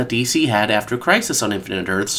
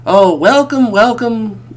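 excited; 7 semitones higher, 5 dB louder, slower than usual. Real, not acted. Enthusiastic repeated welcome.

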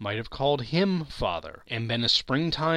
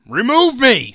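much higher, much louder desperate cry; exclaimed; 10 semitones higher, 14 dB louder than usual. A narrator's reading. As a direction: yell it in desperation. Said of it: "Scrooge exclaimed".

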